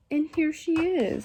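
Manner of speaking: high little bear cub; Baby Bear cry. A narrator's reading. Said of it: "cried Baby Bear".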